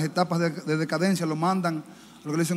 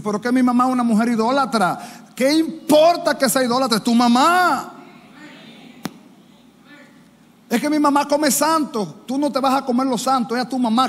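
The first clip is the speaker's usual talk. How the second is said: higher and louder, arguing back the objection; mock indignant objection; 6 semitones higher, 4 dB louder than usual. Preacher mocking a church member's excuse, exasperated.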